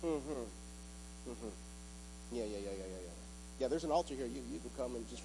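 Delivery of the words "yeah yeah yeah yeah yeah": normal talking voice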